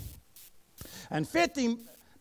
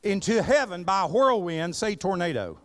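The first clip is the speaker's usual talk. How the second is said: raised, ringing pulpit reading of scripture; 7 semitones higher, 4 dB louder than usual. Acted. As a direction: read the verse out with preaching force. Reading scripture aloud in sermon.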